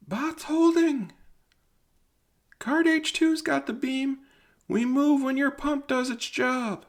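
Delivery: a far-off miner shouting news up the mine shaft; distant voice from below. Acted. Rescuer below calls up warning about Hosk's legs, high and distant.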